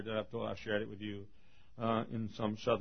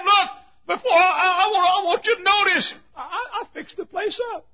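high, loud, stammering plea; insistent old hermit; 14 dB louder than usual. Acted. Hermit pleading that buyers notice repairs, high and worked-up. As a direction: beg them loudly to look at the work.

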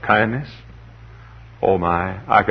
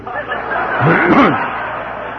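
high breathy chuckle at his own joke; a quick amused laugh; laughing at his own punchline; 8 semitones higher than usual. Real, not acted. Laughs after sucker punchline.

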